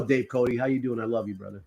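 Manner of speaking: ordinary talk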